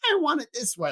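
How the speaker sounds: high-pitched imitation of a little whiner; mock whiny brat. Podcaster imitating what the villain isn't, mock whiny. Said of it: "a whiny little".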